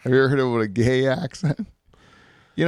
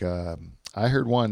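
usual speaking voice

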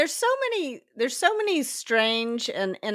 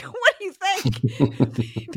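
incredulous retort; 5 semitones higher, much slower than usual. Retold or imitated. Retorting to boyfriend's remark.

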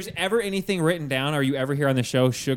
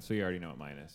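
real whisper, sheepish; hushed confession of ruining the game; 9 semitones lower, 12 dB quieter, much faster than usual. Real, not acted. Whispering to friends after spoiling the game.

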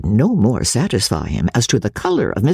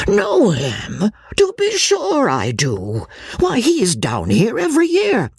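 hearty squire; 10 semitones higher than usual. A narrator's reading. Sir John voiced.

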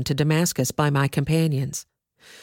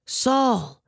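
higher, nearer second call of the name; a heavenly voice calling once more; 6 semitones higher than usual. A narrator's reading. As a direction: call again, closer and more urgent. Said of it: "heard a voice saying to me".

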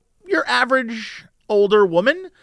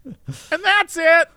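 nervous order; 9 semitones higher, much slower than usual. Retold or imitated. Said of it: "I'm like".

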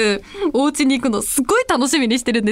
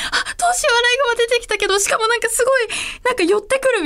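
high, quick flustered fretting; mock panic; 10 semitones higher, faster than usual. Raccoon clinging to her feet, she frets in hammed-up panic. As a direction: fret in a rush as it comes closer.

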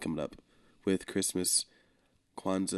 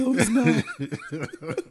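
laughing; 11 semitones higher, 4 dB louder, much slower than usual. Real, not acted. Laughing echo after being scolded.

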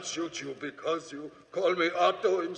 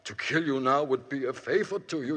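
usual speaking voice

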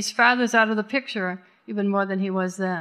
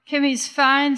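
ordinary delivery

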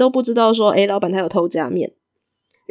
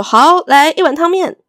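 higher, louder and slow, a cheerful boss; hearty reply; 7 semitones higher, 6 dB louder than usual. Acted. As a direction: answer with easy gusto. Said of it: "老板就很爽快的说". (爽快地高声应答)

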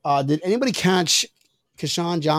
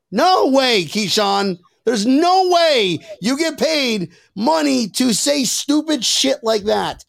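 higher, slow, hammered-out words; emphatic disbelief; 6 semitones higher, slower than usual. Real, not acted. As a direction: spell out your disbelief word by word. Mock-outraged rejection of the remark.

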